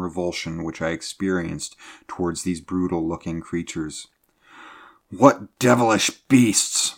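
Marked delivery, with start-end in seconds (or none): 5.1-6.9 louder, higher and slow, appalled captain; disgusted outburst. A narrator's reading. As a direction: burst out in utter disgust. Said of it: "burst out the captain in disgust".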